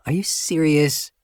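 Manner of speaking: a woman crying out, aghast at the early start; dismayed cry. Acted. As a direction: blurt it out in disbelief. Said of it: "she cries".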